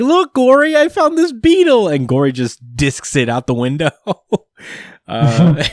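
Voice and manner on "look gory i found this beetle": put-on much higher and louder excited kid voice; little kid thrilled with his find, high and loud; eager little Panda. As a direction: play the baby proudly showing off a bug. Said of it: "He's like".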